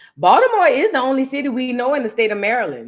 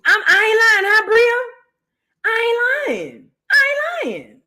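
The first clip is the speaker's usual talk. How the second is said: high-pitched protest; 9 semitones higher than usual. Real, not acted. Swearing to Bria the comparison is true, high-pitched protest. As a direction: voice shoots up high, insisting.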